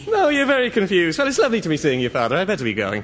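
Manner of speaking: put-on broad stage Irish accent. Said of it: "Copy that accent".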